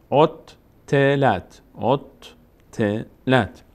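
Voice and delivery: اوتلت drawn out, the doubled t held long; very slow demo. Shown as a demonstration. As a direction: stretch اوتلت slowly so pupils hear both t's. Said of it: "یک بار با کسره خودش ت". («اوتلت» بسیار آهسته و کشیده)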